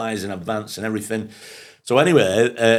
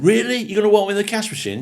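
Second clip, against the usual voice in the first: incredulous promoter; 12 semitones higher than usual. Retold or imitated. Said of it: "And he's going".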